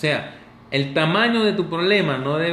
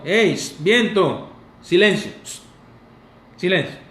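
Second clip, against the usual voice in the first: commanding hush; 3 semitones higher, 5 dB louder than usual. Acted. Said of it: "Solo con Jesús decirle al viento".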